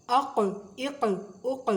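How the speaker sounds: اق with a bouncing echo on the ق; qalqala bounce. Shown as a demonstration. Teacher demonstrating qalqala on قاف. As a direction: shake the قاف so it rebounds. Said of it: "بڑے مزے کی آواز نکلتی ہے ایسے".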